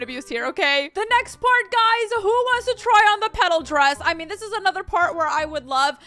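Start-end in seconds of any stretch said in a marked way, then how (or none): none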